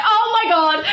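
high, loud squeal of "oh my God"; excited shriek. Retold or imitated. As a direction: squeal it out, thrilled and laughing.

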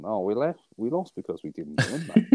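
a light Sheffield accent, mock quote; playful put-on Sheffield accent. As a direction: lightly imitate a Sheffield footballer. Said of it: "in his, uh, Sheffield accent".

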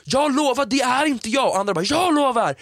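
high, indignant denial; mock angry protest. Angry contestant swearing she is not Mafia, mock high protest. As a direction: imitate a contestant protesting innocence. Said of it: "skitarga och bara".

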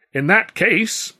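high, loud, slow proclamation; showman clown. A narrator's reading. Clown sets up his gag for the audience, grandly loud. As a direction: draw it out theatrically.

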